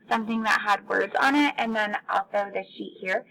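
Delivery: ordinary talk, nothing marked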